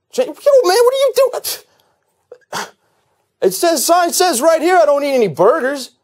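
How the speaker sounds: loud, high-pitched indignant complaining; hammed-up outrage at the handouts; picky homeless person. Homeless picky eater rejects burgers and tuna from passersby, indignant. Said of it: "picky eater homeless person".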